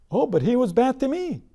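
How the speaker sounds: a grievance building into a quick vow of revenge; resentful thought. Pretend. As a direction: voice a petty grudge, speeding up at the end. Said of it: "And he thinks".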